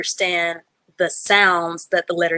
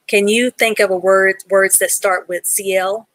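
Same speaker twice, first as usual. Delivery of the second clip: ordinary delivery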